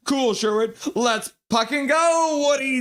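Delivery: high, excited voicing of a fan; elated chat shout-out; hyped-up cheer. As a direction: stretch the cheer slowly upward. Acts out a fan's cheer.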